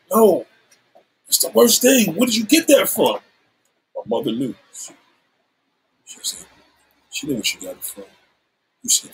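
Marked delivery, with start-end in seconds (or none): 0.1-3.2 high-pitched, appalled reproach; retold scolding. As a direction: imitate someone scolding in disbelief, pitched up. Retold scolding by shocked sister.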